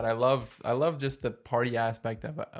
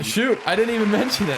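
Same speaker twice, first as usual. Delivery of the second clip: sudden realization; 9 semitones higher, 6 dB louder than usual. Real, not acted. Forgot episode fifty milestone.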